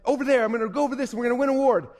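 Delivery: rally everyone toward the prize, playfully excited; excited monkey. Acted. Monkey fired up after buying a coaching program, excitedly. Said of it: "gets all excited".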